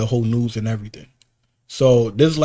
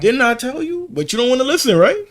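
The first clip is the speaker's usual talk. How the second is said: much higher, louder mocking told-you-so; scolding i told you so; 12 semitones higher, 7 dB louder than usual. Scolding his ex who ignored his warning, high and loud. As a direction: rub it in that she didn't listen.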